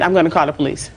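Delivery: normal talking voice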